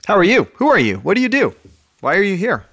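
high, loud quizzing; playful questions. Acted. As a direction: pepper the listener playfully. Rapid playful questions to listener.

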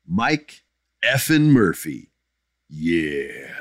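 drawn-out announced name, dropping to a low "yeah"; lightly put-on character voice; Mike effin Murphy. Host introducing a fan's question, lightly put-on voice. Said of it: "This is my Mike effin Murphy voice".

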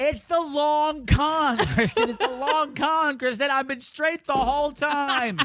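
slow, high, laughing tease. Pretend. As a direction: giggle through a mock confession. Joking confession, laughing.

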